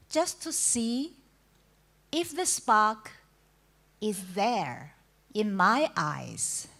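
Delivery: spaced-out poetic phrasing, strong openings falling away; poem recitation. Acted. Poet telling listeners of the spark in eyes, measured recitation. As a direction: recite it line by line, pausing after each phrase. Reads second poem aloud.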